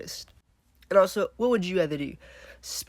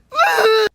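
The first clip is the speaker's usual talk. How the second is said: sharp gasp; 12 dB louder than usual. Real, not acted. Dreading more zombies.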